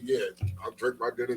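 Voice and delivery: usual speaking voice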